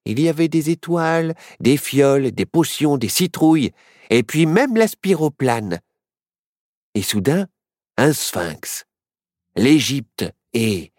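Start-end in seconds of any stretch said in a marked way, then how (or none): none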